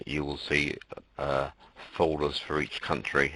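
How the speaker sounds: usual speaking voice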